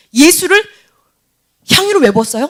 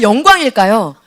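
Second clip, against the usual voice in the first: ordinary talk, nothing marked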